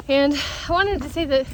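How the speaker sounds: normal talking voice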